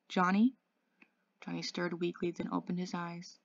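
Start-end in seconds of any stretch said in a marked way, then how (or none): 0.1-0.6 hoarse, rising call of the name. Acted. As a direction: ask his name hoarsely. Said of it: "he said in a hoarse voice".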